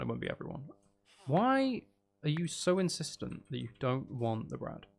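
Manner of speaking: normal talking voice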